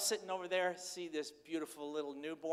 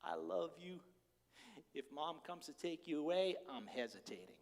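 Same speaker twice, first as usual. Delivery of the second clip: put-on God speaking tenderly to his beloved child; 6 dB quieter than usual. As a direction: speak as a loving father whispering to his child. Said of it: "God looks at you and goes".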